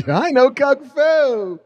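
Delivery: laughing quote. Retold or imitated.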